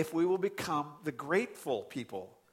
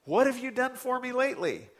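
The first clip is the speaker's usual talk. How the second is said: entitled complainer; 9 semitones higher, 4 dB louder than usual. Retold or imitated. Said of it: "That's humanity".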